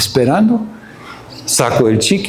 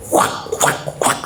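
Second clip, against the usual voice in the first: voiceless spanking sound effects; soft "whack" noises; imitated smacks; 5 dB quieter, slower than usual. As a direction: mimic quick slaps with the mouth.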